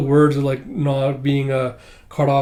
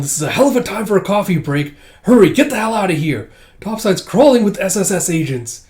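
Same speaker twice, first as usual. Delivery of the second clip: raised, louder hurry-up voice; urgent order; 6 semitones higher, 5 dB louder than usual. Retold or imitated. Disguised old agent tells them to hurry out, urgent mock shout. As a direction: urge them out, acted alarm. Agent urging others to flee.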